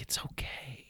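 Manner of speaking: soft whisper, breathy and gentle; whispered reassurance. Retold or imitated. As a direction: imitate her hushed "it's okay". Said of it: "just even the way that you said that".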